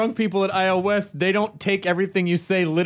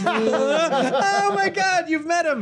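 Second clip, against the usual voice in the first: high laughing exclamation; 10 semitones higher, much slower than usual. Real, not acted. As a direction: laugh it out slowly. Laughing at a shared joke.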